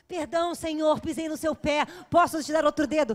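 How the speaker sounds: loud hammed-up begging; mock apology the boyfriend should make, loud and theatrical; mock grand apology. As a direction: declaim it like a servant begging forgiveness.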